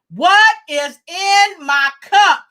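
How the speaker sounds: slow, high, loud chant; audience call-out question. Pretend. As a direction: lead the crowd, stretching each word. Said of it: "y'all ready to ask me".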